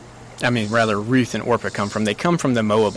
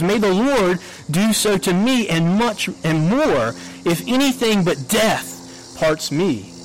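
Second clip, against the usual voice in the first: high-pitched, forceful oath; solemn oath; 7 semitones higher than usual. Acted. Ruth calling a curse upon herself, solemn oath. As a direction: swear it solemnly with raised pitch. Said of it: "call down a curse upon herself".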